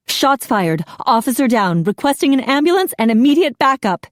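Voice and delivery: officer calling dispatch under fire; urgent radio call. A narrator's reading. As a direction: report in rising urgency.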